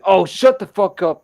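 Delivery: high, loud dismissive outburst; retold dismissal. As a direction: brush off a worrier loudly. Friend brushes off a warning.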